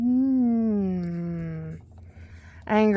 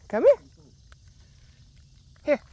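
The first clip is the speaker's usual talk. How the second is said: much higher, squeaky come-here; coaxing pet call; 6 semitones higher than usual. Real, not acted. Fisherman calling the heron to him, high pet-talk call.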